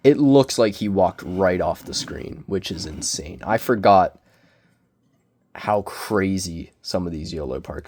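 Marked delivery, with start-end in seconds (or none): none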